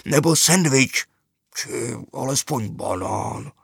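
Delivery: slow, lightly voiced bear begging for a snack; lightly voiced plush bear; a hint of a hungry teddy bear. A narrator's reading. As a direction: drag it out hopefully. Hungry bear asking for food.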